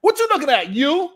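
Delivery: high, loud confrontational stranger; crazy train rider. Retold or imitated. Imitating a stranger boarding the train, high and confrontational. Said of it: "crazy people on the train".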